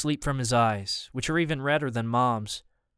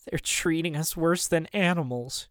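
higher-pitched, understated outcry; distressed cry; 4 semitones higher than usual. A narrator's reading. Mom protests the family's treatment on board, distressed cry. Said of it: "she cries".

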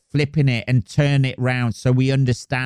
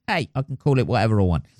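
usual speaking voice

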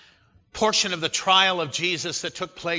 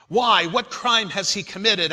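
louder, higher challenge; raised, insistent questioning; protesting question; 5 semitones higher, 6 dB louder than usual. A narrator's reading. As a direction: read Pilate's protest with force. Pilate challenges the crowd.

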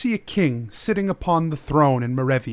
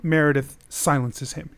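ordinary delivery

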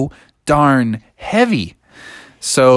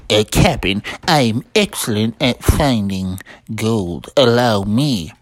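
boastful sailor Smee; 5 semitones lower than usual. A narrator's reading. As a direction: offer expert help to the captain.

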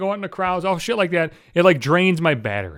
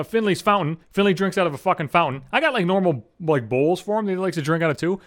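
ordinary talk

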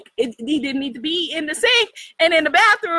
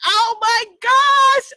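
shocked gasp; 7 semitones higher, 6 dB louder, slower than usual. Retold or imitated. Finding a frog in the sink.

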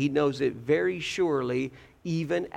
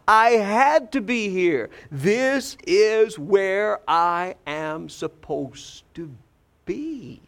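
loud, raised opening on "I had to be here", then slowing; emphatic declaration; 4 semitones higher, 4 dB louder, slower than usual. Retold or imitated. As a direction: declare the calling with force, spacing out the last words. Voices young Jesus declaring his calling.

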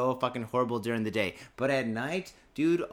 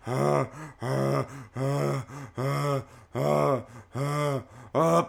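rising, louder mock gasps, panting in a hot microwave; gremlin panting; 3 dB louder, much slower than usual. Comic telling his listeners about a gremlin in a microwave, hammed-up panting. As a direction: pant like a trapped gremlin, building up. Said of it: "he's going".

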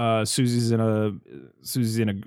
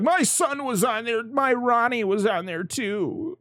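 high, slow whiny rich guy; mock whiny mayor; 10 semitones higher than usual. Mocking the selfish mayor, lightly voiced high whine.

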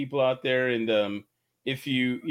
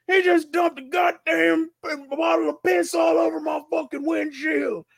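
angry shout; 11 semitones higher than usual. Retold or imitated. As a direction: scream it like an enraged trucker. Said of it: "the guy's like".

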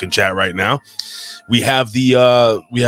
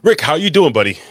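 excited greeting; 7 semitones higher than usual. Real, not acted.

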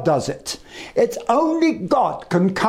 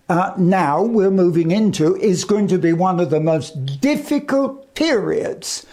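usual speaking voice

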